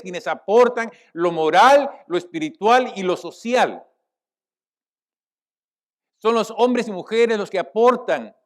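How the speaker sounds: loud, ringing sermon delivery; full-force preaching. Real, not acted. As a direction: drive the point home to the congregation loudly. (predicación a toda voz)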